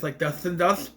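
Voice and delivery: lightly imitating Dustin's way of talking; a hint of a Dustin impression; Dustin-like speech. Recapper showing how injured Jasper talks, lightly imitated Dustin speech. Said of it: "so he talks like this".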